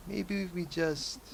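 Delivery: high, slow, tentative plea; hesitant old lady. Acted. Widow to departing young man, hesitant high voice. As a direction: draw it out high, lightly acted.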